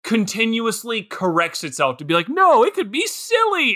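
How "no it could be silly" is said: much higher, a silly singsong; mock goofy movie. The movie voiced as undercutting its own serious tone, high and goofy. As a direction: chirp it like a kooky movie. Voicing the movie correcting itself.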